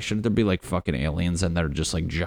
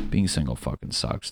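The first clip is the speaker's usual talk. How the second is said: ordinary delivery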